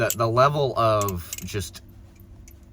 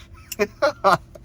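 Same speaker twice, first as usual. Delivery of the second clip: high burst of laughter; loud laugh; 10 semitones higher, 5 dB louder than usual. Real, not acted. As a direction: let out a big disbelieving laugh.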